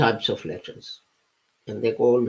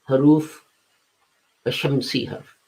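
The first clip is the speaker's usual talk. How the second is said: ordinary talk, nothing marked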